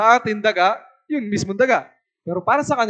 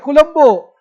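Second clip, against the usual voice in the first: higher, loud street-seller's cry; imitated market hawker's yell; vendor's shout; 6 semitones higher, 9 dB louder, slower than usual. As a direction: call out your wares to passers-by. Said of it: "Di sumisigaw siya".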